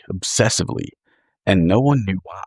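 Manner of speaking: usual speaking voice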